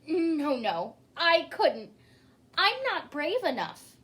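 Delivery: loud, panicky no no; backing away in loud fear; alarmed refusal. Acted.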